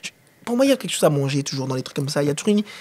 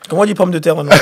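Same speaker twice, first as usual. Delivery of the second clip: louder question laughed through; teasing laugh; 10 dB louder, faster than usual. Real, not acted. Host teasing guest about his English, laughing. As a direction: tease him while laughing.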